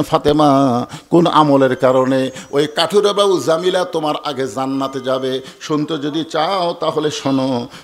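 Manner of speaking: half-chanted waz storytelling; sermon storytelling. Acted. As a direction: tell the holy tale in a singsong cadence. Chanted telling of Ali and Fatima.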